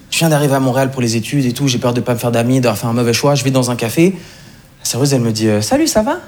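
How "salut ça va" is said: high, sing-song Québécois greeting; put-on Québécois accent. Waitress greets a café customer, Québécois accent. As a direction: imitate a Québécois waitress saying hello. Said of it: "j'ai pris l'accent québécois".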